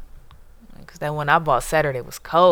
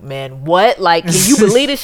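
louder, amazed retelling; disbelieving excitement; 3 semitones higher, 11 dB louder than usual. Retold or imitated. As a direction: replay the shock of hearing the news. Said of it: "we'll be like".

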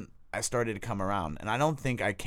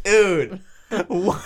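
shocked; 10 semitones higher, 10 dB louder than usual. Retold or imitated. Shocked waking in rehab.

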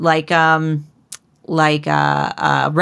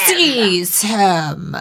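drawn-out, hammed-up order; imitating an imperious ruler ordering guards; mock villain command; 3 semitones higher than usual. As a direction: stretch it like a theatrical tyrant. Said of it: "she's just like".

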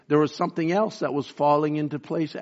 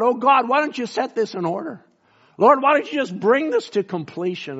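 exasperated cry; 8 semitones higher than usual. Retold or imitated. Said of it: "we just want to cry out".

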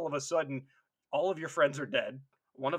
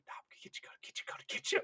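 hushed creepy whisper, unvoiced; repeated low whisper; 7 dB quieter, much faster than usual. Giant Marine answering the complaint in a whisper. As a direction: murmur the threat over and over. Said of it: "that low whisper and they repeatedly say".

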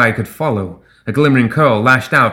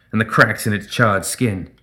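ordinary delivery

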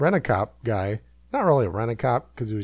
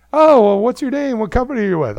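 higher, louder bright hostess; mock hostess voice; 10 semitones higher, 7 dB louder than usual. Hostess questioning Eric at the door, mock hostess voice. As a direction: play the hostess asking politely.

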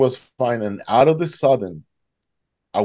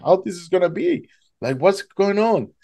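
alarmed confusion; 8 semitones higher than usual. Retold or imitated. Panicking as the current pulls him.

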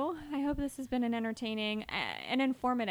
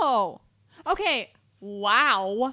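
loud disgusted reaction; 11 dB louder, much slower than usual. Real, not acted. Disgusted reaction to baby meat.

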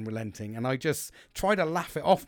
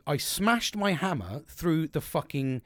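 ordinary talk

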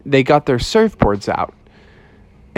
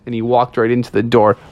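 usual speaking voice

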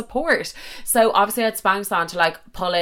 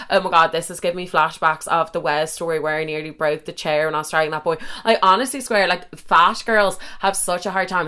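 ordinary delivery

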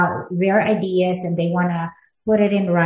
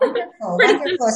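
high, bright-eyed wonderful, louder on the second; delighted praise; 11 semitones higher, 7 dB louder, faster than usual. Real, not acted. Host praising the guest's dog stories, rising excited and loud. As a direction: gush with real delight. Host reacting to touching story.

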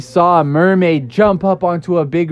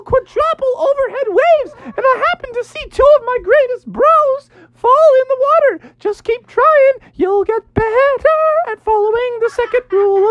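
very high falsetto surfer hero; jolly high-pitched bravado; Daniel Tiger. Acted. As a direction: whoop it up like a cartoon surfer dude. Plays surfer rescuer Daniel Tiger.

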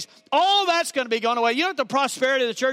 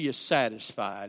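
tender hush; 14 semitones lower, 7 dB quieter than usual. Acted. Savoring the word satisfied.

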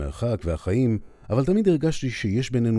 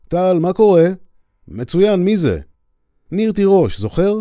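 raised, higher voice calling down a phone line; loud phone call; 9 semitones higher, 8 dB louder than usual. Acted. Narrator calling old army friend Tal, raised and high. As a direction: speak up over a bad connection.

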